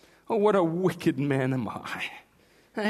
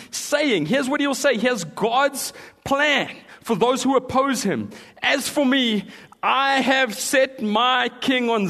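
loud, high preaching; full-force preaching; 7 semitones higher, 6 dB louder than usual. Acted. Preacher booms the psalm to the congregation, full force. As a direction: preach it out to the crowd.

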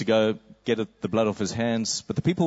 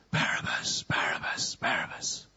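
softer, whispered name; faint distant whisper; 7 dB quieter than usual. Acted. Prisoner hears his name through the bars, whispered. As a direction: whisper it like a far-off crowd. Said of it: "he just hears".

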